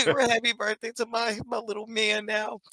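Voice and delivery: laughing through the words, delighted; laughing birthday wish. Real, not acted. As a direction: giggle while wishing your son happy birthday.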